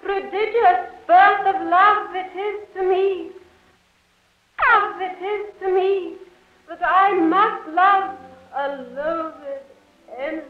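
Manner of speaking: lovelorn verse over music; a girl torn by love, reciting; Juliet onstage. Acted.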